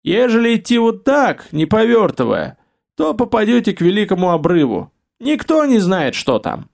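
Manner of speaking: put-on high cracked old man voice. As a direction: rasp it like an old grandfather. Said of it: "Его догнал надтреснутый голос". (надтреснутый стариковский голос)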